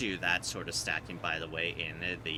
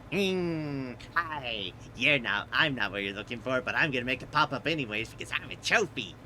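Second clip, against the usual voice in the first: smug trophy voice; 4 semitones higher than usual. Retold or imitated.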